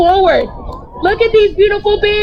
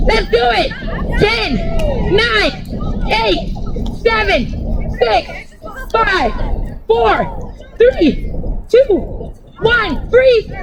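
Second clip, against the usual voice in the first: excited countdown; 6 semitones higher, slower than usual. Real, not acted. Teacher counting the kids through jumping jacks, excited. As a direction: count down with lots of energy.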